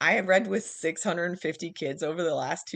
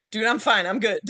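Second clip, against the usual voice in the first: louder, laughing brush-off; laughing reply; 4 semitones higher, 5 dB louder than usual. Retold or imitated. Texting her boss back, laughing it off. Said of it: "I'm like".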